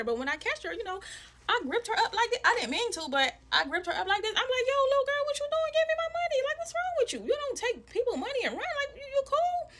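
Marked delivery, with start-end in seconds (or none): 4.7-9.7 high-pitched, loud scolding retold; heated confrontation. Confronting a little girl who took her money, heated and high-pitched. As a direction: imitate yourself telling a child off, high and loud.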